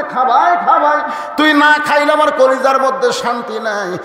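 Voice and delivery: hammed-up loving sister coaxing her brother to eat; doting coaxing sister. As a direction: fuss over him like a doting sister.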